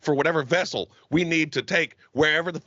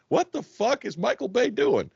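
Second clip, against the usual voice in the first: incredulous; 8 semitones higher than usual. Retold or imitated. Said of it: "you're just sitting there going".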